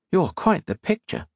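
playful, gently mocking; teasing. A narrator's reading. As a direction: tease him lightly. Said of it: "Octavia kidded".

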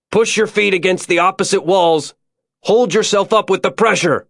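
high, sustained distance call; shouted up. A narrator's reading. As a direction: call urgent instructions up the shaft. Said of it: "like he'd shouted up to her".

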